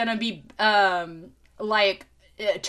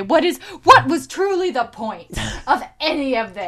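exasperated rant; 2 semitones higher, 7 dB louder than usual. Retold or imitated. Venting about pointless quest.

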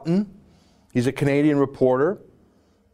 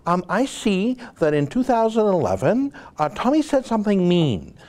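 higher, put-on posh British accent mocking the interviewer; lightly hammed-up imitation of a prim British presenter; mocking the BBC host in a British accent; 5 semitones higher than usual. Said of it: "I'm doing an accent".

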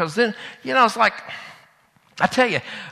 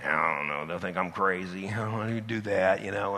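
much lower, softer timid excuse; reluctant mumble; 12 semitones lower, 11 dB quieter than usual. Retold or imitated. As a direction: shrink back and mumble the excuse. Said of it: "we're so reluctant".

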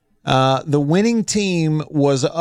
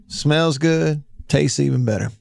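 ordinary delivery

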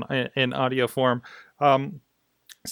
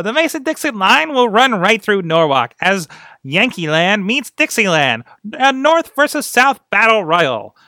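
high, loud vintage fight-card announcer; old-timey announcer voice; 5 semitones higher, 5 dB louder than usual. Acted. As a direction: sell it like a 1920s carnival barker. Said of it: "read that voice in your head".

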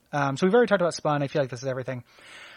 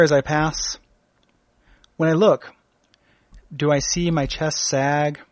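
slow dramatic poetry recital; poem reading. Acted. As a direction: read it like a solemn poet.

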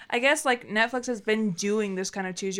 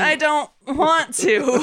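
louder, higher, drawn-out mock protest; acted reluctance; 6 semitones higher, 6 dB louder, slower than usual. Partner refusing to take game risks, drawn-out whine. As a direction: drag it out as a pleading refusal.

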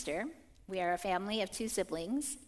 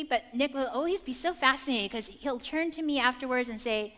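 ordinary delivery

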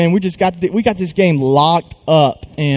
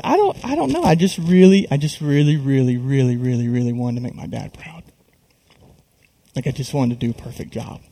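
retold tearful confession; 4 dB quieter than usual. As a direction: replay being a puddle, voice sinking away. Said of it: "I'm a puddle".

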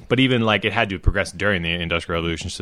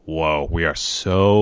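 southern drawl; 3 semitones lower, much slower than usual. Retold or imitated. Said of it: "places in the South that's just like".

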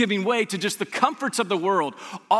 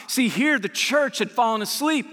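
ordinary talk

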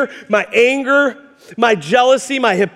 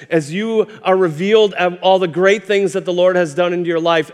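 normal talking voice

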